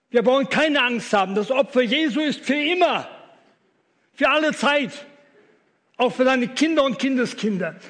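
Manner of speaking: loud, high, impassioned sermon voice; thundering pulpit preaching; fiery preaching. Acted. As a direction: preach it out to the whole hall. Preaches at full force.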